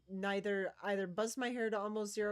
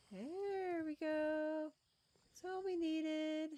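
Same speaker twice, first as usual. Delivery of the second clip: tender coo; 8 semitones higher, much slower than usual. Real, not acted. Tender higher slow voice.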